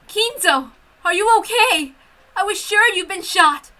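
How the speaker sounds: worried woman. Acted. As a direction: rush the relief out.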